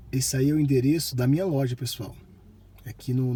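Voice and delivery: usual speaking voice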